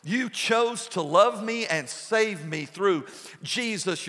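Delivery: ordinary talk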